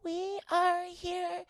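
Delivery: high, very slow cartoon alien voice; little monsters. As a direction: announce it in a tiny drawn-out squeak.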